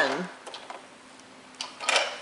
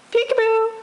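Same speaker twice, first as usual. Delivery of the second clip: high playful peekaboo; 11 semitones higher than usual. Acted. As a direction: sing it up high to surprise the baby. Peekaboo game with toddler.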